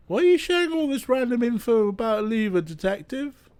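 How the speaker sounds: high, quick questioning game character; pytająca postać z gry. Acted. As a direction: ask the detective in a high, hurried voice.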